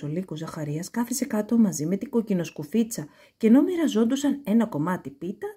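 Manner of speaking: usual speaking voice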